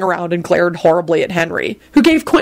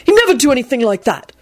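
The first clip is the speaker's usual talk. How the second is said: mildly menacing, raised-pitch denial; outrage with a threatening edge; 6 semitones higher than usual. A narrator's reading. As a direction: warn them off indignantly. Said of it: "he added threateningly".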